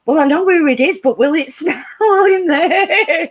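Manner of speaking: high-pitched question breaking into laughter; laughing retold protest. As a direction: crack up while acting out your own blurt. Retells blurted question, laughing.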